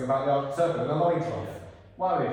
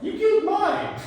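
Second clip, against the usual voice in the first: much higher, loud squealing comeback; Ninjago meme voice; 9 dB louder than usual. Shown as a demonstration. Creature firing back at Victor in a squealing meme voice. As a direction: shriek it back like the Lloyd TikTok meme. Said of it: "I want you to live it in that voice".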